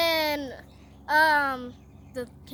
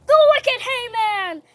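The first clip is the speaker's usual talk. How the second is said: loud, high denunciation; name the culprit at full voice; dramatic accusation; 7 semitones higher, 13 dB louder than usual. Acted. Queen exposing Haman to king, accusing.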